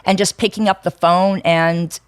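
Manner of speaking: normal talking voice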